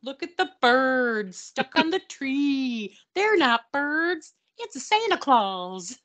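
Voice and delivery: slow, high singsong protest; hammed-up childlike correction; mock kid voice. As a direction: draw each word out, pitched high. Acts kid answering dad, mock voice.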